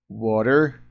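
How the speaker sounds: American "Water" with a tapped t and final r; American accent. Shown as a demonstration. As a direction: say "Water" the American way, like WAH-der. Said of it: "o sotaque americano é mais parecido com isso".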